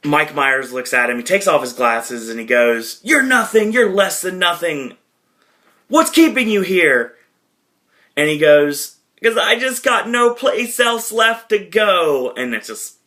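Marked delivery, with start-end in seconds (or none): 3.0-7.1 high-pitched hammed-up taunting; acted confrontation, pitched well up; mock berating. Acted berating in movie scene. 9.2-12.3 high, slow mock-desperate plea; drawn-out, high-pitched melodramatic confession; hammed-up freak-out. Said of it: "he starts freaking out".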